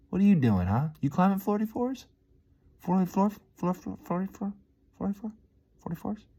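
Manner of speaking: playful high-pitched taunt; mock squeaky teasing. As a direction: tease him in a squeaky high voice. Mocking teasing about forty floors.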